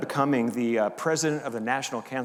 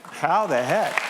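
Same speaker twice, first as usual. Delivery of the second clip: louder, punched amazement; emphatic repeat; 3 semitones higher, 6 dB louder, slower than usual. Real, not acted. Pastor asking the congregation, louder and amazed. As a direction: throw the question out to the room.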